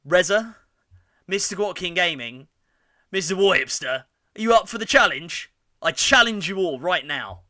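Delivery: high, loud rallying call; loud challenge. Real, not acted. Streamer challenging fellow YouTubers, loud and high. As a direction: throw down the gauntlet loudly.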